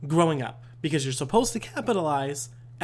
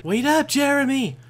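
mock pleading call; 6 semitones higher than usual. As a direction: call after a teammate who is leaving you behind. Playfully calls after teammate.

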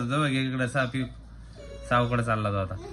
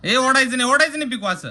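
higher, louder warning; sharp scold; 9 semitones higher, 5 dB louder than usual. Real, not acted. Parent scolding child for pulling the baby, high loud warning. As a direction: stop the pulling now.

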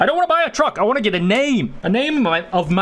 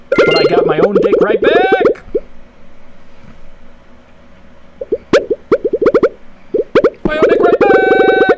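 triumphant squeal; 6 dB louder than usual. Pretend. Gloating after getting eaten.

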